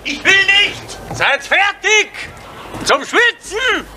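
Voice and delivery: very high, drawn-out cry; insistent calling out. Acted. Breaking off a quarrel, insistent high call. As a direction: push the voice right up and stretch it.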